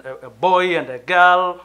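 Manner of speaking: ordinary talk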